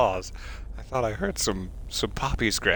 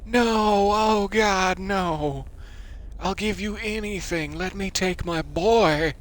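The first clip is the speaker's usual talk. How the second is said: hammed-up pleading; 7 semitones higher than usual. Player parting from the cube, hammed-up pleading. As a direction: beg like losing your child.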